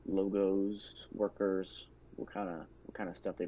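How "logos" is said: normal talking voice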